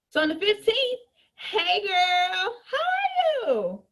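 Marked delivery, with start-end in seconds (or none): 1.3-3.8 high, slow gushing hello to a customer; sweet, sing-song hey girl; bubbly greeting. Acted. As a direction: fake-friendly call to a customer. Acts a bubbly call to a customer.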